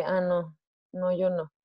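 ordinary delivery

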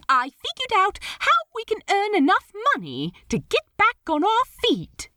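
high, fast and eager; eager excited pitch. Acted. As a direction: rush it out, bursting with an idea.

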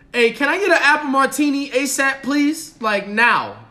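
loud, pushy order at the host; loud, entitled demand. Retold or imitated. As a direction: bark the order like he's owed it. Man demanding a drink from host.

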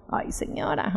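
whispered aside. Retold or imitated. Reacción ante madre en el súper.

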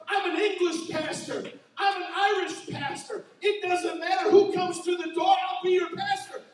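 ordinary talk, nothing marked

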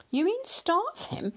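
high-pitched, aghast query, fully voiced; shocked question. A narrator's reading. Husband questioning his wife's plan for the cat, shocked. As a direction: react appalled to the suggestion. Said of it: "whispered Mister Grinling".

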